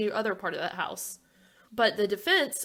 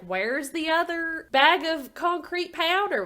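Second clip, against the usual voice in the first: higher, drawn-out TV host interrogation; Nancy Grace; 7 semitones higher, 3 dB louder, slower than usual. Acted. Co-host mimics TV host questioning the defense, drawn-out impression. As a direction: demand it slowly in a put-on voice. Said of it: "You do the best Nancy Grace".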